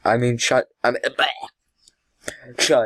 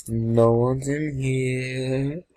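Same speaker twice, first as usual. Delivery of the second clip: "No one's in here" stretched slowly, half singsong; slow, lingering call on "No one's in here"; drawn out; much slower than usual. Pretend. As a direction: draw out every word, holding "here" long. Searching rooms, repeated drawn out.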